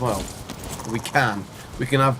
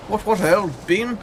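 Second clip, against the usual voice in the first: posh British; 6 semitones higher, slower than usual. Pretend. Mock posh British phrase.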